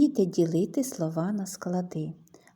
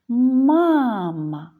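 calling out; 3 semitones higher, 7 dB louder than usual. Shown as a demonstration. Teacher shows children the stress, «Мама» called out. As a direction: call «Мама», stretching the stressed MA. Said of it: "необхідно слово погукати".

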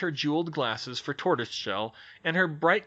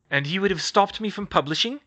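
louder challenging question; demanding; 6 semitones higher, 5 dB louder than usual. Acted. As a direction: Skeeter exigindo resposta. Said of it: "Skeeter demanded".

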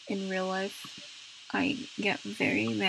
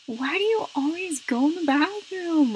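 scolding yell; 5 semitones higher, 8 dB louder than usual. Pretend. Scolding herself as "girl.

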